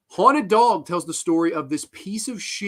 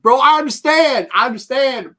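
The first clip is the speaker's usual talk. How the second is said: louder, higher, insistent; loud rant; 7 semitones higher, 9 dB louder than usual. Real, not acted. Reviewer shouting his concession at the filmmakers. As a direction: hammer the point home at them.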